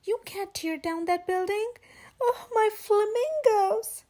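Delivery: high, loud, crying plea; wailing over her flamingos; desperate cry. Acted. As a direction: beg them to stop, near tears. Flamingos about to be wrecked.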